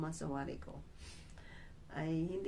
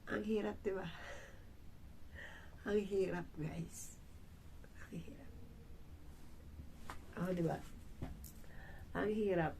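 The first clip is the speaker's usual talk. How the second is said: crying; 2 semitones higher, 5 dB quieter than usual. Real, not acted. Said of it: "Naiyak ako sa inyo".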